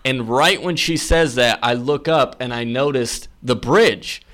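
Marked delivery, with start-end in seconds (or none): none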